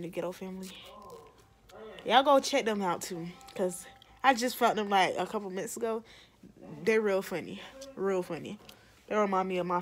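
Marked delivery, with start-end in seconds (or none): none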